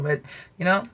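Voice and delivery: normal talking voice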